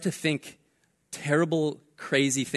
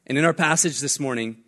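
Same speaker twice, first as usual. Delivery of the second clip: usual speaking voice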